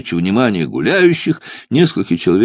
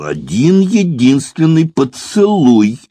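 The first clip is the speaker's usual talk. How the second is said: high, loud-edged melodramatic howl; unnatural wailing demand; 8 semitones higher, 4 dB louder than usual. A narrator's reading. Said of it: "с подвыванием голосом потребовал".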